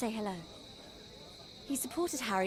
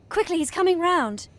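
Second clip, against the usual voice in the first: urgent call; 5 semitones higher, 7 dB louder than usual. Acted. Harry waking up.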